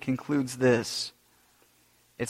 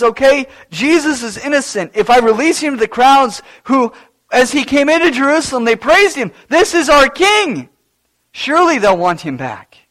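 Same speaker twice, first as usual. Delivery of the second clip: rising, louder voice of Pilate thinking aloud; voicing Pilate; 7 semitones higher, 6 dB louder than usual. Preacher voices Pilate's hopeful reasoning, higher and louder. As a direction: Pilate reasoning he'll be freed. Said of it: "Pilate's own thought is".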